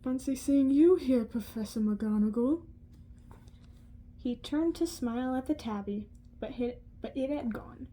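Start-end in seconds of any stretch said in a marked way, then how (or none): 0.0-2.6 lightly voiced wise old wizard, a touch louder. A narrator's reading. Old wizard greets the cat, mildly amused. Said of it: "he spoke to it".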